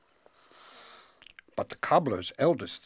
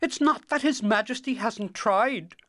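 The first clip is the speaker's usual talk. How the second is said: high, louder queenly voice; loyal queen; 8 dB louder than usual. A narrator's reading. Queen defends His Majesty's efforts, loyally. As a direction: speak up high in the king's defence. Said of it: "the queen spoke up loyally".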